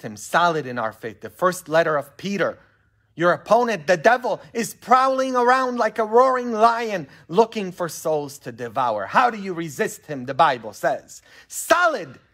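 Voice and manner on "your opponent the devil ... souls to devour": high, forceful preaching; declaiming scripture. Acted. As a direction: build the warning as you quote it.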